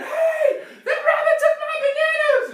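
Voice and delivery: very high, loud laughing yell; screaming lady. Acted. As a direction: yell after the thief, cracking up. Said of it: "and she's screaming".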